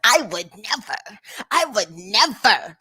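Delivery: slow, prissy mock of the lady's line; mocking imitation. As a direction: lightly parrot her pearl-clutching.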